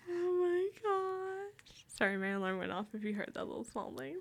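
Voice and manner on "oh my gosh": slow, high drawn-out oh my gosh; drawn-out amazement. Real, not acted. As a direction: stretch it out in disbelief. Reacting to the story.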